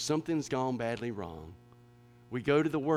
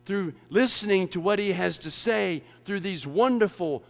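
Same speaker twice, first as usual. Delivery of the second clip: louder, driving sermon voice; full-force preaching; 7 semitones higher, 9 dB louder than usual. Real, not acted. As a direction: preach it hard to the congregation.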